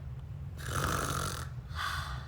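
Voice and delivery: long breathy sleepy sigh. Acted. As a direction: sigh as you drift off. Falling asleep.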